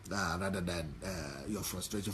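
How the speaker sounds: ordinary delivery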